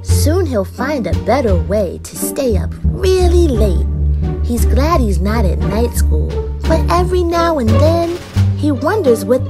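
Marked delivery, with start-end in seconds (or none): none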